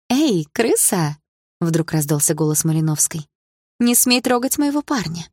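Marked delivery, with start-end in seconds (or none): 0.1-1.2 high, drawn-out taunting call; mean girl calling out. A narrator's reading. Rival girl calls out aggressively. 3.8-5.3 high-pitched indignant demand; mean girl's warning. A narrator's reading. Rival girl warns heroine off.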